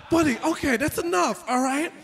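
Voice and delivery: loud, high mock exasperation; exasperated cut-off. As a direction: shut the kid down loudly. Teacher cutting off nosy kid.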